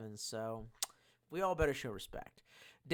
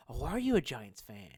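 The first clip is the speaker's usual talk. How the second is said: ordinary delivery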